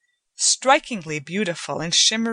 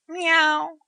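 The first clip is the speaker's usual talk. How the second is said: much higher imitated meow; cat's meow; 11 semitones higher, 4 dB louder than usual. A narrator's reading. The father as a cat answers Joanna with a much higher meow. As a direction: mew like a cat. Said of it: "he said".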